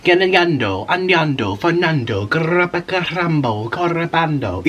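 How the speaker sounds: made-up Spanish words with rolled R's; mock Spanish. Vlogger showing viewers how Spanish sounds to her, mock Spanish. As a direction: imitate Spanish as a non-speaker hears it, syllable after syllable. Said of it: "this is what it all sounds like to me".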